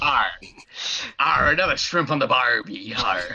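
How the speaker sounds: lightly voiced Aussie pirate; a hint of a pirate talking barbecue; Australian pirate. A player joins in, lightly voicing an Australian pirate. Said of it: "That's an Australian pirate".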